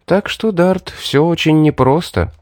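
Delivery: lightly theatrical lament; affected weariness. A narrator's reading. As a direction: lay it on with mock resignation. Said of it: "девушка делано вздохнула".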